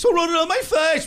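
high-pitched, panicked sitcom villain; Newman. Acted. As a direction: cry it out high, comically alarmed. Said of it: "the guy who plays Newman".

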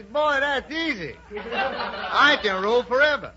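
high, loud, slow comic bragging; confident boast. Acted. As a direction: crow it out in drawn-out words. Sam boasts in the comic story.

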